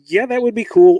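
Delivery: lightly imitated eager colleague; keen approval. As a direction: act out an enthusiastic go-ahead. Acting out colleagues approving his idea.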